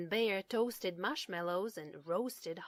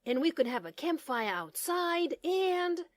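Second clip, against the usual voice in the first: higher, louder, tumbling ahead with plans; a little turtle bursting with ideas; eager rush; 8 semitones higher, 7 dB louder than usual. Acted. Little turtle pitching campfire plans to mother, eagerly. Franklin rushing on excitedly.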